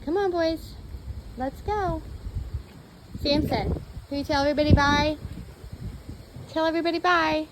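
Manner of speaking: high, loud, fast calling; rally the dogs excitedly; excited pet talk. Real, not acted. Calling the dogs to wave bye.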